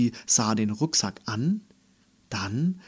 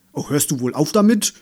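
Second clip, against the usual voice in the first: quick, louder grumpy protest; complaining; 4 semitones higher, 7 dB louder, much faster than usual. A narrator's reading. Toy unicorn with a swaying belly, complaining. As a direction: complain in a higher voice, hurriedly. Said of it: "beschwerte er sich".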